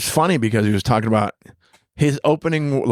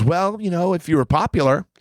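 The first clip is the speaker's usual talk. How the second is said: higher-pitched, pointed mock politeness; imitated needling remark; sly dig; 7 semitones higher than usual. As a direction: slip in a little dig, imitating her. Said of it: "tried to get a little dig in".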